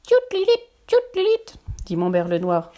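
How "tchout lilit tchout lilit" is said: high chirping birdsong; blackbird call. Acted. Blackbird answering Poulet des Bois, high chirping.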